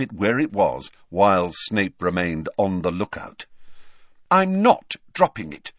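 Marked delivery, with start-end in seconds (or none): 4.3-5.7 high, loud and insistent; firm, emphatic refusal. A narrator's reading. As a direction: refuse to budge, with force. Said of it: "said Hermione firmly".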